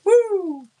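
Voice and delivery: high, loud whoop; imitated hollering. Cousins hollering through the woods, loud mock whoop. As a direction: let out a long hunting call woo.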